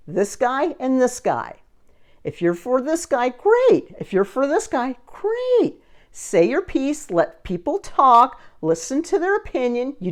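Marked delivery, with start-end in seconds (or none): 3.3-3.9 bright approval. Real, not acted. Cheerful approval of either side. 5.1-5.8 bright approval. Real, not acted. Cheerful approval of either side.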